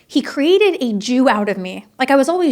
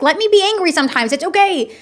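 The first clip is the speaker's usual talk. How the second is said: put-on teen protest; 7 semitones higher than usual. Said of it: "really be upset at him".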